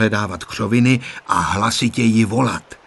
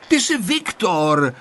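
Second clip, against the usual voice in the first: high, drawn-out happy recognition; mild cry of recognition; exclaiming; 8 semitones higher, slower than usual. A narrator's reading. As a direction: exclaim it on recognising a friend, pitched up. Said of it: "vykřikl Honzík".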